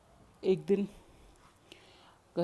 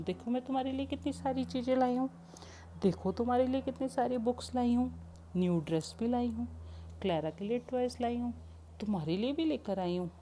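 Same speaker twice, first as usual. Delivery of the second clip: eager coaxing; 6 semitones higher than usual. Acted. Grandmother shows gifts to child.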